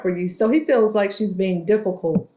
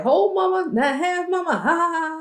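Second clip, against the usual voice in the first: teasing high voice; 8 semitones higher than usual. Retold or imitated. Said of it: "Tiffany like".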